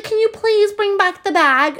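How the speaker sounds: put-on squeaky high phone voice of the store girl; high-pitched voice of the mall employee on the phone; high-pitched sales girl. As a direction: mimic the sweet high voice of the saleswoman. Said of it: "with, like, a high-pitched voice".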